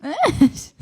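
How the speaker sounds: sharp, high call to the dog. Real, not acted. The dog jumps up on her.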